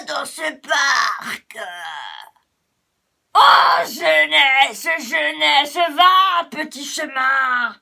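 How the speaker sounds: loud, high whining complaint; old grumblers shouting at a youngster; cranky old paths. Acted. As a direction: shoo the young one away, annoyed.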